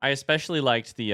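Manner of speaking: ordinary talk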